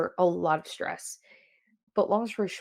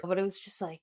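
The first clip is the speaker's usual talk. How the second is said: ordinary delivery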